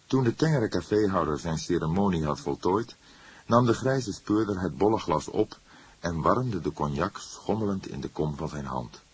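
plain reading voice

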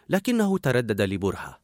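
ordinary delivery, nothing marked

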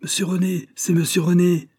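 high, loud cry of recognition; crowd crying out. A narrator's reading. Voices in the mob recognising René, crying out. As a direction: call his name out from the crowd. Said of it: "s'écrièrent plusieurs voix".

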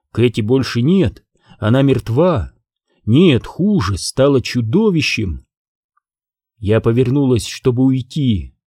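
usual speaking voice

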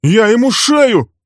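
high, slow, loud raging shout; young man threatening Walter, furious. Acted.

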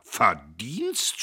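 outraged loud Verdienst?; Verdienst? hurled back loud and rising; shouted question. A narrator's reading. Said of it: "schrie Dietrich".

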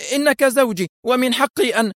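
very high, urgent outcry; crying out. A narrator's reading. Wife insists on her rights to her husband, crying out. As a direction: plead your claim in a high cry. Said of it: "صاحت".

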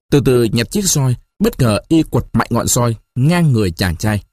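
ordinary delivery

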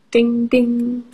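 ordinary talk

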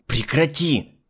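sharp angry command; boiling over. A narrator's reading. Irritated woman snapping at a crying girl. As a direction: snap at the child to stop. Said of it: "вскипела Альбина".

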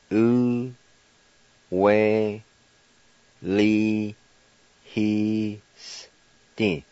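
oo, we, li, he, sti spoken one syllable at a time. Shown as a demonstration. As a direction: break it into slow separate syllables. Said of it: "and it's pronounced".

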